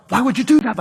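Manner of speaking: retold burst of eager surprise; excited. Mechanic questioning the man paying for her repair, excited. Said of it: "he got, like, excited".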